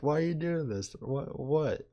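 high, lightly mocking repeated questions; high-pitched mock bafflement; imitated patronizing rider. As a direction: imitate someone needling with innocent questions. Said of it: "over and over, like".

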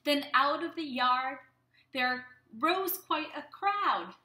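lilting rhymed read-aloud; reading to children. Acted. Teacher reading a Mother's Day poem to kids.

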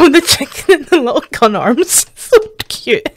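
real laughter breaking into the words; tickled, high giggly delight; giggling. Real, not acted. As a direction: giggle helplessly through it, pitch climbing. Giggling at a cute clip.

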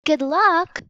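understated farewell shout; mild shout. A narrator's reading. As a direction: call it out high, lightly. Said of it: "Annie waved and shouted".

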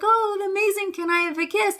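high, excited sing-song praise; cooing at the bird. Retold or imitated. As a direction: gush at a pet bird in a high voice. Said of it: "And I was like".

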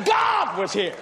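high, loud, exasperated yelling; telling his wife God came, high shout; excited shout. Retold or imitated. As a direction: shout it at her in disbelief.

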